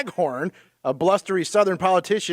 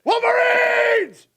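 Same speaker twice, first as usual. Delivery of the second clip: high, drawn-out movie war cry; mock battle cry; 3 dB louder, much slower than usual. Quoting Red Dawn to friends, hammed-up battle cry. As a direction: holler it long and high like a rallying call.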